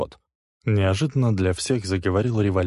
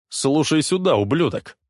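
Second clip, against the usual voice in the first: wheezing; 7 semitones higher, 4 dB louder than usual. A narrator's reading. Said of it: "прохрипел Лесли".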